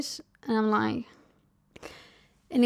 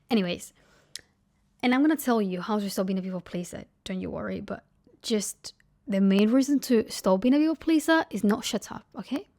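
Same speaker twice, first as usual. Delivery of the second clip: ordinary talk, nothing marked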